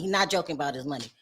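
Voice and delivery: low, soft, fast aside on not; quiet aside repeat. Shown as a demonstration. Muttering the repeat to viewers, low and soft. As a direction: drop it low and quick.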